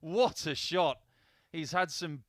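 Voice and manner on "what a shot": loud delighted call; excited. Real, not acted. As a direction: cheer the big finish. Bullseye wins the match.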